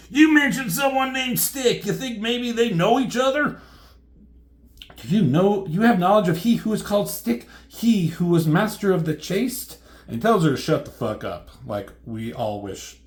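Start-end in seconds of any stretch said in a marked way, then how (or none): 0.1-3.6 high, loud mocking character voice; sarcastic retort. Character mocking Elektra over the name Stick, loud and sarcastic. 5.0-9.8 raised, solemnly theatrical voice; grandiose heroine. Acted. Elektra asking after her master, high and grandiose.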